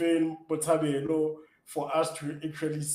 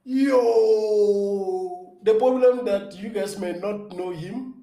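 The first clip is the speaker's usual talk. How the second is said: long loud high "Yo" then raised pitch; excited exclamation; 6 semitones higher, 10 dB louder, much slower than usual. Real, not acted. Guest asked to name a hero, excited outburst. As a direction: burst out loudly, voice lifted high. Delighted reaction to favourite-hero question.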